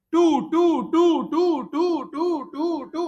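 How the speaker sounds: chanting the repeating digit for students; endless repeating. Shown as a demonstration. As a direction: drag the टू टू टू on and on like it never stops. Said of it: "ये क्या चल रहा था बेटा".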